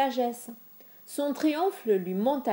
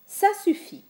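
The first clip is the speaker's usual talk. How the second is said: sharp order, pitched much higher; a sudden higher, louder order; commanding; 12 semitones higher, 5 dB louder than usual. Acted. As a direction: cut him off with a command.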